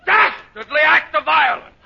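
high, loud outraged bluster; furious comic tirade; irate scolding. Acted. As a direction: bellow at the culprit, indignant. Principal scolds her after crash.

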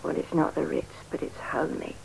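soft whispered apology for the room. Acted. Showing room, hushed.

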